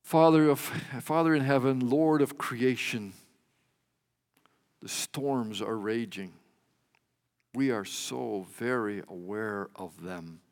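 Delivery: earnest spoken prayer, swelling and sinking. Acted. Pastor praying for a congregation weighed down by storms, earnest prayer. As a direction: lay the fears before God.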